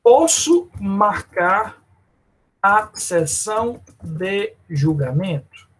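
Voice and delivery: slow, drawn-out judge's question. Retold or imitated. Teacher voicing the judge to students, slow and deliberate. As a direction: spell out the question word by word. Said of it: "responda a seguinte pergunta".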